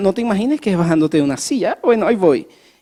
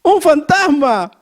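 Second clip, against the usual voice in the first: mock terrified cry, higher and louder, slow; grito de miedo; 9 semitones higher, 5 dB louder, slower than usual. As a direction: cry out like a frightened disciple. Repite el grito de los discípulos. (grito asustado, más agudo y fuerte, lento)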